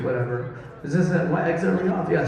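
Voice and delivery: ordinary talk, nothing marked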